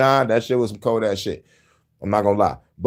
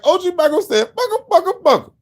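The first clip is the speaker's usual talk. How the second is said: mock rapper yell. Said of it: "he was like".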